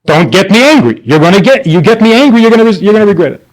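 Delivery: high, agitated imitation of a parent; mock parental threat, tumbling over restarts; threatening parent. Parent warning a kid they'll regret it, agitated mock threat. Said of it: "So when parents say".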